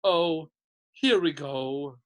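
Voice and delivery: slow, weary resentment; bitter. A narrator's reading. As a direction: drag it out with bitter resignation. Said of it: "said Nearly Headless Nick bitterly".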